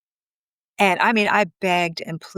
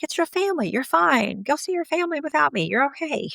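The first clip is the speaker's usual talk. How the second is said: high, light coaxing voice; retold breezy encouragement, pitched well up; reassuring; 8 semitones higher than usual. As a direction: wave away his worry in a raised, easy lilt. Wife reassuring husband, retold.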